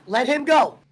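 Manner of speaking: ordinary delivery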